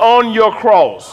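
call-and-response lead. Shown as a demonstration. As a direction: stretch each word for the crowd to echo.

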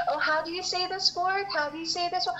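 high-pitched, put-on fascinated questioning; mock curiosity. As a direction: imitate nosy coworkers cooing their questions. Mimics coworkers quizzing the newcomer.

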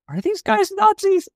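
squeaky high innocent question; high mock question. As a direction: ask it in a high silly voice.

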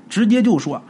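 plain reading voice

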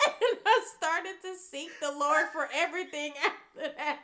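high, loud laughing talk; laughing while telling. Real, not acted. Telling the fasting story, laughing at herself. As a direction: talk through the giggles.

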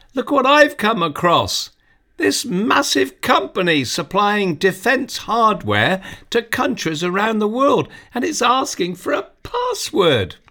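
put-on a kid showing off a discovery; young boy Tony. Narrator voices excited Tony.

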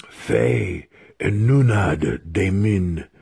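slow, drawn-out spell chant; trance incantation. A narrator's reading. Wizard restarts his incantation after a slip, solemn slow chanting. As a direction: intone each strange word at half pace. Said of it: "slowly sunk into his deepest meditative trance".

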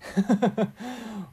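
short chuckle, falling away; amused laugh. Real, not acted. Radio host recalling his morning nosebleed, amused laugh. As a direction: chuckle at your own mishap.

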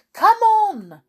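urgent call. A narrator's reading. Griffin hurries Alice.

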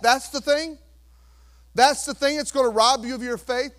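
ordinary delivery

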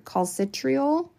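calcitriol said slowly and high; careful slow pronunciation. Real, not acted. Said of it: "I'm gonna butcher this word".

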